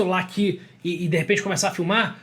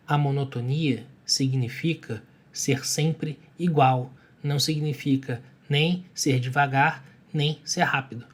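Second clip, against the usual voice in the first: low, even, dragging words with long gaps; slow monotone; 5 semitones lower, 4 dB quieter, slower than usual. Shown as a demonstration. Coach explaining monotony by example, slow monotone. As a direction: spell it out evenly and very slowly. Keeps demonstrating the monotone pausing.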